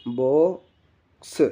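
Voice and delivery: very slow, drawn-out reading; teacher sounding out a new word slowly; slow spelled word. Shown as a demonstration. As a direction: stretch the word out for the class. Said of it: "ऐसे देखो मैं बताता हूँ".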